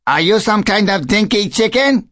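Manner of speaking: high-pitched taunting mynah bird; cheeky question in a high bird voice. A narrator's reading. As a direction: needle the newcomer in a high bird voice.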